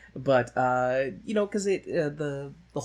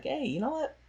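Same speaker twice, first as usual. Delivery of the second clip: put-on cheeky flower girl; 11 semitones higher, faster than usual. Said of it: "is kinda like".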